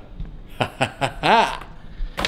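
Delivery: staccato laugh rising at the end; breathy ha-ha-ha burst. Real, not acted. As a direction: laugh in quick separate bursts. Laughs after drawn-out word.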